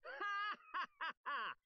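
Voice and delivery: proud ringing laugh; haughty laugh. Acted. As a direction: laugh like a smug victor. Triumphant laugh.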